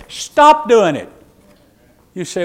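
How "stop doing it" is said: louder, higher, slow "Stop doing it."; preacher's blunt command to the congregation. Real, not acted. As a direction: order it firmly, word by word.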